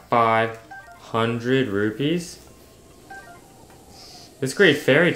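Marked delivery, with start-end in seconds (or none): 0.1-2.4 slow, drawn-out disbelieving question; incredulous. Real, not acted. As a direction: hold each word in disbelief. Shocked at the fairy's price.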